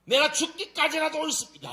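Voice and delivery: high, loud mock defiance; imitating an insolent, temperamental prophet; defiant back-talk. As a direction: talk back stubbornly at full voice. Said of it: "이렇게 개기는 놈 처음 봤네".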